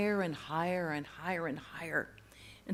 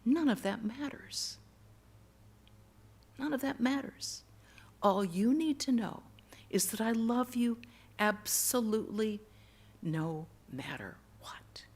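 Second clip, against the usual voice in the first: gentle, patient voice, slowing to a hush; loving reassurance; 3 semitones higher than usual. Retold or imitated. Loving voice answers the boy's challenge, patient and tender. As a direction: repeat it tenderly with long pauses, softening at the end. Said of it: "The voice just kept saying".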